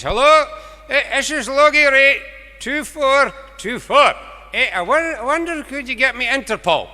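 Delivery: put-on a bumbling old farmer shouting down the line; old farmer on the phone. Old farmer shouting down the phone to the operator.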